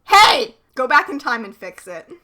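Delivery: loud mock-furious yelling of the writers' names; angry shout. Real, not acted. Cohost shouting at the Jem writers to fix the plot, loud mock fury. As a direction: call the writers out across time.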